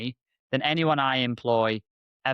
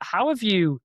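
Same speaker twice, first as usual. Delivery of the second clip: retold disbelief; 9 semitones higher, 4 dB louder, slower than usual. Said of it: "I was like".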